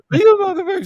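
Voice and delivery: hammed-up screaming fit; mock furious Jimmy. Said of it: "he loses his fucking mind".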